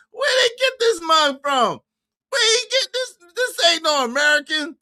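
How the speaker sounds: high-pitched indignant protest; incredulous. Real, not acted. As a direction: voice shooting up in disbelief. Disbelief at the speaker.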